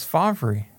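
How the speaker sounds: "Favre" said as FAH-vuh-ree; spelling pronunciation. Shown as a demonstration. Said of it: "Why do they pronounce it Favre?".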